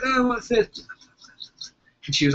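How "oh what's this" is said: high surprised mum. Retold or imitated. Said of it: "And she was like".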